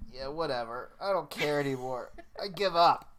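laughing defeat; laughing surrender. Real, not acted. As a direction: throw up your hands with a laugh.

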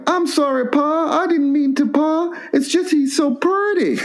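hammed-up backwoods boy whimpering sorry; whiny hillbilly boy. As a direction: beg your pa like a simple country kid. The boy voiced in story.

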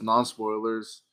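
ordinary talk

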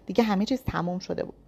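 ordinary delivery, nothing marked

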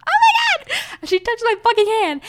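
high, loud fangirl shriek; thrilled squeal. Real, not acted. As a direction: squeal with disbelief that she touched you. [name] touched her hand.

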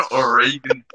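"Oregon" as oh-RAY-gun; mock mispronunciation. Joining in the teasing over a state name, mock mispronunciation. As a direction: echo the botched "Oregon" with RAY in the middle. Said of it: "might as well just say Oregon".

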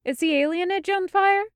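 high, startled question; alarmed question. A narrator's reading. Isabella to the breathless Meryl, high startled question. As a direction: ask it half in alarm.

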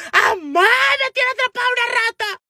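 very high shriek to mother; re-enacted hysterical squealing; panicked scream. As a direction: scream for help in hammed-up terror. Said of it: "empecé a gritar a madre".

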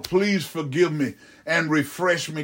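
normal talking voice

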